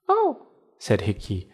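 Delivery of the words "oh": very high tiny cricket voice. Acted. Cricket reacts.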